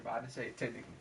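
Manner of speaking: ordinary talk, nothing marked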